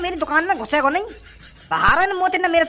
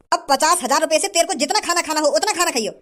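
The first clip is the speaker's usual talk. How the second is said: normal talking voice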